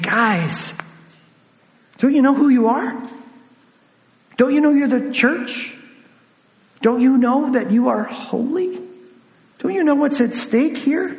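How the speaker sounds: high-pitched, pleading fatherly questions. Acted. Paul to the church about who they are, in a fatherly tone. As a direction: appeal like a concerned father, voice lifted, pausing after each question. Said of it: "you can hear Paul's fatherly tone".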